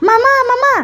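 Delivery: put-on high, loud child's cry for his mother; a boy yelling to mum in the kitchen; shouting for mum. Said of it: "ο Μιχαλάκης βάζει τις φωνές". (παιδί φωνάζει τη μαμά, ψηλά και δυνατά)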